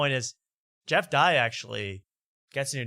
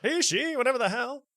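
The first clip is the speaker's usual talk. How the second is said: very high-pitched dismissive mimicry; high mock exasperation; Jeff Dye impression; 13 semitones higher than usual. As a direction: squeal it as an exaggerated imitation. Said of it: "Yeah, he does this".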